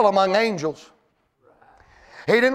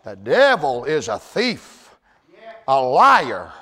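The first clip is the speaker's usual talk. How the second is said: much lower, slow and weighty; low dramatic preaching; 3 semitones lower, slower than usual. Acted. Preacher naming the devil to the congregation, low and slow. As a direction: lean in low and heavy.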